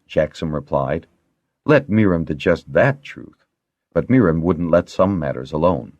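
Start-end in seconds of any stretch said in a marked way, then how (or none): none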